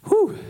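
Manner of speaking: a high, sharp "whew" of release; relieved whew. Real, not acted. Pastor catching his breath mid-sermon, sharp high whew. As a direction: blow out the breath after heavy words. Pastor pauses, exhales heavily.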